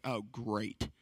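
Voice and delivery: low, soft sarcastic aside; resigned dismay. Retold or imitated. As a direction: drop it low and quiet, mock resigned. Retold dismay at being picked first.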